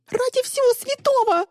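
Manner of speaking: very high panicked squeal; shriek. A narrator's reading. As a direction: shriek it in desperation. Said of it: "завизжала Мира". (истошный визг)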